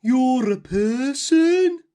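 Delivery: slow, loud booming giant; big giant voice. A narrator's reading. Giant feels something in his mouth, slow loud booming voice. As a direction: boom it out like a sleepy giant. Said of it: "the big voice said".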